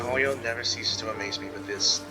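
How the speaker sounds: ordinary talk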